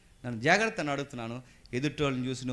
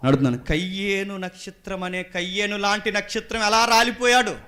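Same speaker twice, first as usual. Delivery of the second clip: raised pitch building to a loud question; forceful preaching; 7 semitones higher, 10 dB louder than usual. Acted. Preacher asking how Cain's star fell, forceful preaching. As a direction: throw the question out to the congregation.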